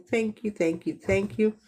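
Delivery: ordinary talk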